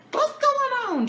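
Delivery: very high, bewildered squeak; mock bewilderment. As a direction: squeal the question in comic confusion. Acting out her bewildered reaction.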